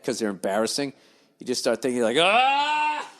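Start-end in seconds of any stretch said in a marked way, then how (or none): 2.2-3.1 sudden high yelp; a high startled shower shriek; mock shower yell. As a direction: blurt out a high yelp of shame. Shouting bad thoughts out in shower.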